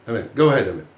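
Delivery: ordinary delivery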